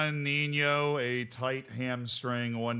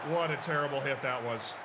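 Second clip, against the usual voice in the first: ordinary talk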